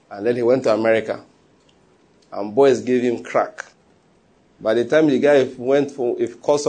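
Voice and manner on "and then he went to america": normal talking voice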